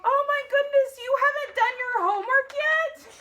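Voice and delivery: high, loud "Oh my goodness"; exasperated mom. Shown as a demonstration. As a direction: scold like a flustered mom. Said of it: "heard your mommy say that".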